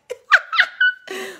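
a burst of very high laughter; real squealing laugh. Real, not acted. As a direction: giggle up high and bright. Laughing at husband's quote.

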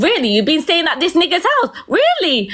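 high-pitched, incredulous imitation; retold angry disbelief. Baby father confronting her about another man's house, retold angry disbelief. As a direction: mimic an outraged accusation, pitch shooting right up.

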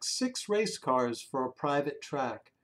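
ordinary delivery

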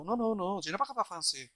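quick French phrase with a French accent. Shown as a demonstration. Example of speaking languages fast with accent, lively French accent. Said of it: "with accent".

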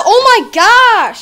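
loud, high replay of an outburst; demonstrating own exclamation. Shown as a demonstration. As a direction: show loudly how you exclaimed it. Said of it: "That's how I said it".

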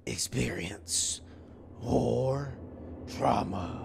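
slow, soft eerie whisper of the title; spooky whisper. Pretend. Gamer whispering the game's title to creep out viewers, hushed. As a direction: breathe it out like a horror trailer.

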